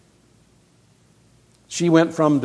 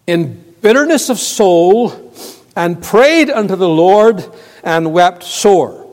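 raised, loud pulpit reading; forceful scripture reading; 5 semitones higher, 7 dB louder than usual. Acted. Preacher reading Hannah's verse to the congregation, forceful. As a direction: declaim the verse, drawing out the last words.

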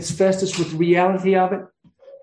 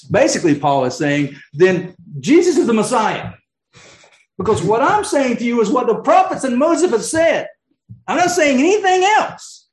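high, loud urgent appeal as Paul; pleading passionately, pitch climbing; Paul pressing the king, high and loud; impassioned plea; 8 semitones higher, 3 dB louder than usual. Acted. Preacher voicing Paul's appeal.

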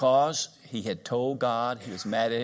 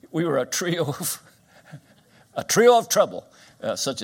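laughing; 5 semitones higher, 7 dB louder than usual. Real, not acted. Laughing about boyhood mischief.

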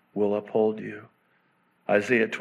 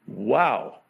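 ordinary talk

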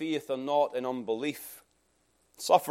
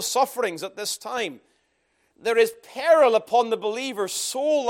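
louder, raised preaching voice; full-force preaching; 7 semitones higher, 5 dB louder than usual. Acted. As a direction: preach it out to the congregation.

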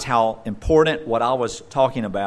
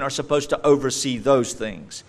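normal talking voice

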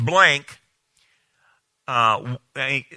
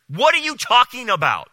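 usual speaking voice

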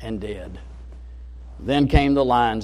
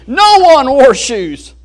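excited version; 11 semitones higher, 13 dB louder, slower than usual. Acted. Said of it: "all excited".

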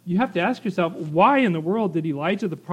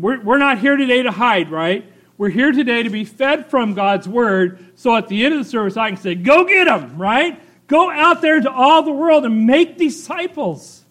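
higher, louder charge to the church; rousing preaching; 5 semitones higher, 6 dB louder than usual. Acted. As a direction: send them out like a rallying cry.

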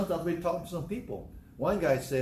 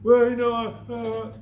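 hesitant mumbling; 7 semitones higher, 8 dB louder than usual. Retold or imitated. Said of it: "stop talking like that to yourself".

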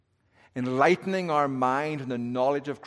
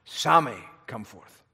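summoning call; 5 dB louder than usual. Pretend. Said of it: "a voice goes forth".

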